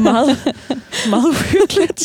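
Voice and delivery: laughs, then squeals the words high and loud; laughing. Real, not acted. Speaker laughs as she tells her listener the scene is creepy, laughing high. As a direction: laugh it out, amused and a bit creeped out.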